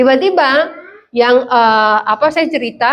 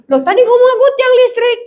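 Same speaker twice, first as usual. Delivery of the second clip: very high-pitched indignant comeback; protesting child; 9 semitones higher than usual. Retold or imitated. Teased child answering back before the teacher, protesting. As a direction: imitate a child arguing back.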